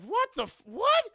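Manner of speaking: high, loud scared what; mock mom spooked by something walking by; startled shriek. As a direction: shriek it out in fright. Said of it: "she's like".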